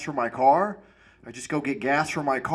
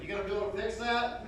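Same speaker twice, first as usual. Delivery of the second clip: much softer, higher plea; quiet mock question; 5 semitones higher, 12 dB quieter than usual. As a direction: ask it timidly. Asking a stranger quietly.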